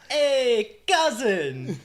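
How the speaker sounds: put-on high, loud Eastern European accent; GTA cousin voice. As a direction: call out like the game cousin. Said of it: "Just repeating G T A four".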